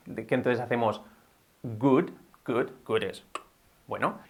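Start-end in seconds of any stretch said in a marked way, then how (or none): 1.6-3.0 "Good" with a hard g in place of w; closed-throat error. Shown as a demonstration. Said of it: "o cerramos la garganta".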